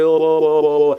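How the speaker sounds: calling "oh" over and over; bawling out. Acted. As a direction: holler a string of alarmed calls. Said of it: "बंब लागले".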